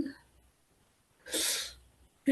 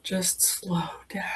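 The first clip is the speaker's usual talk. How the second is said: soft, low and slow whisper; 6 semitones lower, 9 dB quieter than usual. Shown as a demonstration. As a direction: let it fade to breath. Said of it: "Say it really slow".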